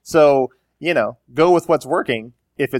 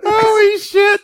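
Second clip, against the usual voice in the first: laughing shout; 5 dB louder, slower than usual. Real, not acted. Laughing outburst.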